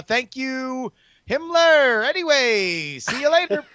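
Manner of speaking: sing-song high goodbye; high sign-off. Pretend. Signing off call.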